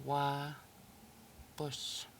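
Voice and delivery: So wapus stretched out very slowly; slow teaching pronunciation; very slow, drawn out. Shown as a demonstration. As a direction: draw wapus out so each sound is clear.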